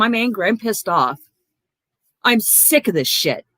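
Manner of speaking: usual speaking voice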